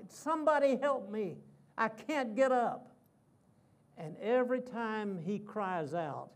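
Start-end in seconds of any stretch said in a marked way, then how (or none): 0.1-2.9 loud, high pleading cry of a pinned man; crying out for help. Retold or imitated. Wrestler pinned by his son cries for help, loud and high. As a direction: call out for help, hammed-up and playful. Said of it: "And he keeps crying out".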